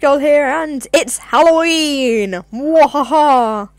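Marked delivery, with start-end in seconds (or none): 2.5-3.7 slow evil laugh; mad scientist cackle. Pretend.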